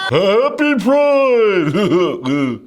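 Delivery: high, stretched-out mocking "Happy Pride!"; hammed-up gushing politician; mock Trudeau. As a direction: parody him, loud and drawn out.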